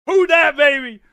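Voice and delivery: loud, high rival chant; taunting shout. Real, not acted. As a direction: holler it at rival fans.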